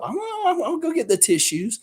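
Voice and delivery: high, loud, hammed-up weepy; teasing a viewer who teared up, mock sobbing tone; mock teary. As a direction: pretend to go fetch tissues. Mock tearful reply to chat.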